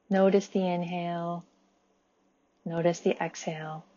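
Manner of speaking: quiet, unhurried breath guidance with space after it; guided meditation. Acted. Inviting the group to sit with their breath, guided meditation.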